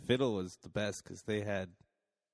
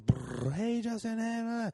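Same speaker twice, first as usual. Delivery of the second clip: excited fangirl; 11 semitones higher than usual. Retold or imitated. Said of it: "And the girls will".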